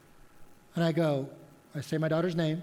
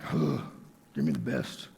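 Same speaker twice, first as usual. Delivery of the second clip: low, gruff tough-guy voice; mock tough customer; 5 semitones lower, 4 dB quieter than usual. Customer demanding the best at the lawnmower shop, mock tough. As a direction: drop it low like a macho tool buyer.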